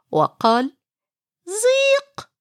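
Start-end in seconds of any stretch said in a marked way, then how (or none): 1.5-2.3 very high squeaky mouse squeak. Acted. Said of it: "في صورة فأر".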